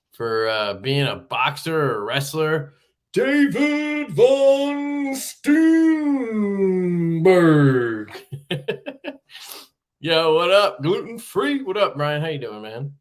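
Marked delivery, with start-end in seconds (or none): 3.1-6.4 big, high, stretched-out boxing announcer intro; ring announcer call. Acted. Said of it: "an N B A game or". 6.5-9.1 laughing. Real, not acted. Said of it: "laughs at own announcer bit".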